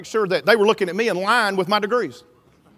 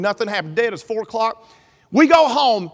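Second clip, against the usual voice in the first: ordinary talk, nothing marked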